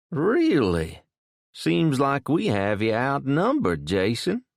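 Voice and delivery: South Louisiana drawl. A narrator's reading. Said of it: "in a thick South Louisiana drawl".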